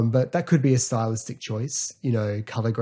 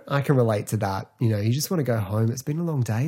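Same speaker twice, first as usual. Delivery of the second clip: normal talking voice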